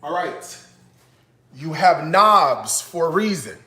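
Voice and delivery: ordinary talk, nothing marked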